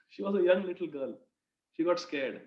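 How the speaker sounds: ordinary delivery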